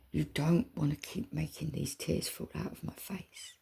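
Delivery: hushed, pleading whisper. Real, not acted. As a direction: keep it to a whisper. Whispered plea continues.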